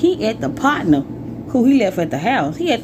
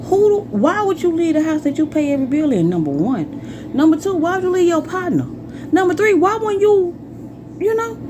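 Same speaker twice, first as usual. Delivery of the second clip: indignant; 8 semitones higher than usual. Real, not acted. Rhetorical indignant questions.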